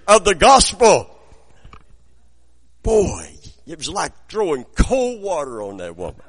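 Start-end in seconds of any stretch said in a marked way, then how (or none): none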